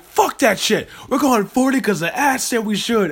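loud, high retold gambler's bravado; defiant hype. Host retells defying the table to bet, hyped-up defiance. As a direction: throw it out big and high, all in.

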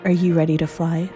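normal talking voice

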